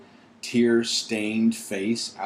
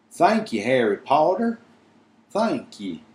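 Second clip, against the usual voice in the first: breathless elf; 5 semitones higher, 4 dB louder than usual. Acted. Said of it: "said Dobby breathlessly".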